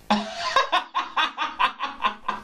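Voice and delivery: high, breathy run of giggles; real high-pitched cackling laugh. Real, not acted. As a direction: burst into a long, rattling chuckle. Laughs after listing food words.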